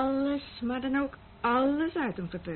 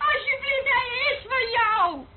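high and loud, laughter cracking into tears on "hij is van jou"; hysterical laughing into crying; 12 semitones higher, 5 dB louder than usual. Acted. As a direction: offer him up half laughing, half sobbing.

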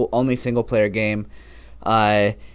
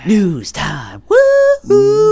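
much higher, loud whoop; excited cheer; 8 dB louder, much slower than usual. Acted. Co-host hyping the news segment with a whoop. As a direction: hype up the segment.

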